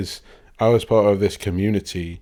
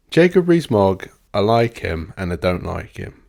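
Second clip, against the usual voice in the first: ordinary delivery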